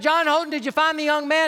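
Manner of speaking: storyteller acting out a shout; retold yell. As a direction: holler a question through the fog. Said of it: "He yelled out".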